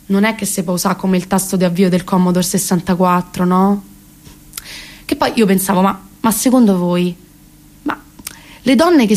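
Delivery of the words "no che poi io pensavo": ordinary talk, nothing marked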